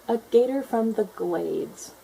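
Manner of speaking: slow proud gator. A narrator's reading. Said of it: "she said".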